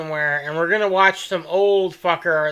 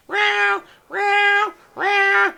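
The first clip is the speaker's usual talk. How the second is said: high, whiny meows like a cat begging to play; cat meowing; 12 semitones higher, 3 dB louder, much slower than usual. Retold or imitated. Host imitating his cat pestering him mid-podcast, high meows. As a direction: meow up high like the cat wanting her toy. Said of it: "all I hear is".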